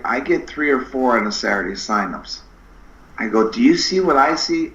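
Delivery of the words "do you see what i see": raised, teasing question; mock mysterious. Setting up a movie joke, a raised teasing question. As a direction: set up the ghost line playfully. Spooky movie quote lead-in.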